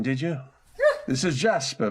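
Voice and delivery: ordinary talk, nothing marked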